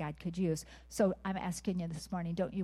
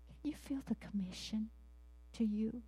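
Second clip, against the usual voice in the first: whispered, softer question; hushed appeal; 3 semitones higher, 5 dB quieter than usual. Real, not acted. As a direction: lean in and whisper it to the room. Intimate appeal to congregation.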